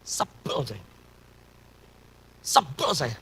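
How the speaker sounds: soft, low mock grumble; quietly fed up, replayed for listeners; retold sulky grumble. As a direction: sulk under your breath, reenacted. Retelling his sulking in taxi.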